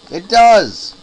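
sudden high, loud outburst; excited shout. Real, not acted. Gamer realizing the turret shoots, excited shout. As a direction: blurt it out in surprise.

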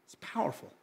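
breathy whisper on "powerful". Acted. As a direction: drop to a whisper for "It's powerful". Repeats phrase, second whispered.

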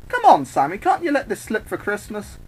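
high, pleading wheedle; cajoling, pitched well up; wheedling. Acted. Harley wheedles her captor.

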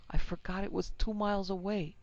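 high and loud, fighting to get free; frantic mother struggling free; frantic pleading. A narrator's reading. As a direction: push against him in desperation. Wife struggles to open door.

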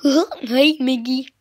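dopey chuckle and loud cartoon greeting; chuckle, then hail a pal in a dopey voice; Goofy. Vlogger showing it turns into Goofy greeting Mickey, goofy voice. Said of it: "it's my goofy voice".

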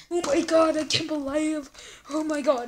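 ordinary talk, nothing marked

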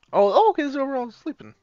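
sudden high surprised oh. Real, not acted. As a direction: jump up in surprise. Surprise at dorm.

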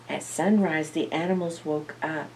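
usual speaking voice

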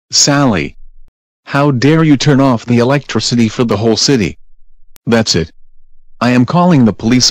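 furious shout. Acted. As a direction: shout it at the naughty kid. Parent scolds Sally loudly.

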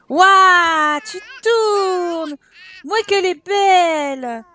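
high, loud delighted wow; excited cheer. Real, not acted. Cheering dancing child. (s'émerveiller devant la petite qui tourne)

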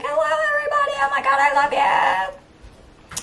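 very high, gushing hyper greeting; too excited. Acted. Vlogger greeting viewers after a long break, overexcited. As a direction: squeal a hello as if overjoyed. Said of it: "Just too excited".

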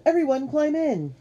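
high, loud summons; urgent call. A narrator's reading. Q ordering everyone into the trembling boat, urgent call. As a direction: hurry them all aboard. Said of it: "Q called".

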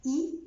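"إي" said high with spread smiling lips. Shown as a demonstration. Teacher showing the French é sound. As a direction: smile while saying "إي". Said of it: "كأني ببتسم".

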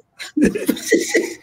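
squealing high-pitched laugh; a real, breathless high cackle; S1 laughing at the size joke. Real, not acted. S1 cracking up at joke.

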